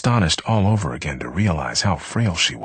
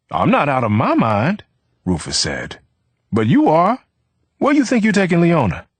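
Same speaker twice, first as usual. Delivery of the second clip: high, loud sneer; hostile challenge; 12 semitones higher, 5 dB louder than usual. A narrator's reading. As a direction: throw it back at him. Rufus challenging Vivaldo.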